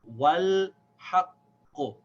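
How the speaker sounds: stretched demo of "Wal haqqu"; skipped alif. Shown as a demonstration. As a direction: break "Wal haqqu" into drawn-out pieces. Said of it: "we're skipping this alif".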